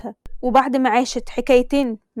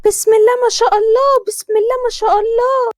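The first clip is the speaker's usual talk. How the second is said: put-on higher, louder gushing older woman; groom's mother blessing; 10 semitones higher, 4 dB louder than usual. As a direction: lay the blessing on thick, pitched up. Groom's mother greets bride. (حماة بتبارك بصوت عالي ومبالغ)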